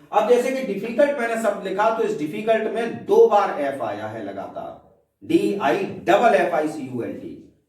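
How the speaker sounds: ordinary talk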